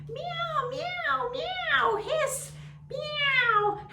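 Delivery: very high loud meows and a hiss; yowling cats fighting outside the door; cats meowing. Acted.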